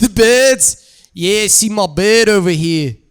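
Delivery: broad mock-British slang; put-on British vowel on "birds"; British accent. Shown as a demonstration. [name] shows off his bird in a broad mock-British accent. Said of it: "switch up to the British".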